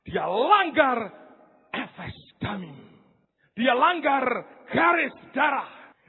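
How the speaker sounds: slow, loud, hammering each word; emphatic preaching. Acted. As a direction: drive the climax home to the congregation. Climax of David story. (khotbah lantang dan lambat)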